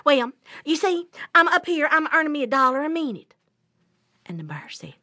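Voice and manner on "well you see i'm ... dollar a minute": high, loud, quick patter; fast-talking rabbit. Acted. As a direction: rattle it off like a trickster rabbit. Rabbit cons the bear.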